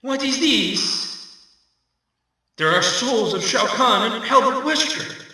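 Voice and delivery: usual speaking voice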